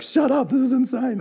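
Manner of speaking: high, loud cry of disbelief; overwhelmed outburst. Acted. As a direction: burst out as if overwhelmed with awe. Imagined reaction to Jesus preaching.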